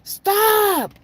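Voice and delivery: put-on high, loud child's yell; kids yelling. Little kids yelling at the brawl, high child shout. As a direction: shout like a frightened little kid. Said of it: "the little kids was yelling".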